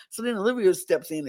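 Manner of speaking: ordinary delivery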